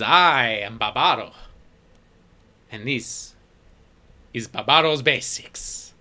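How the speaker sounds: loud, proud merchant introduction; proud shopkeeper. Acted. Shopkeeper Barbaro proudly introducing his shop to the party, loud and proud. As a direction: announce your shop with pride. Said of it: "he says with pride".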